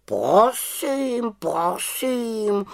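high, drawn-out huffy raven; miffed raven voice; offended. A narrator's reading. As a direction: take offence, raising and stretching each word. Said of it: "urazil se Abraxas".

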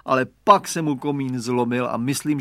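ordinary delivery, nothing marked

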